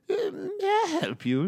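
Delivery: put-on high, thin old-lady voice; high-pitched elderly gardener; elderly woman. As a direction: pipe up as a puzzled old neighbour. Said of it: "there's a elderly woman ... she'll say".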